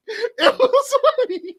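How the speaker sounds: real laughter spilling through the words; genuinely cracking up; laughing. Real, not acted. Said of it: "And I'm laughing because".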